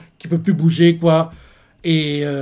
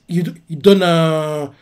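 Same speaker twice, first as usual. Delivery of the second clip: ordinary delivery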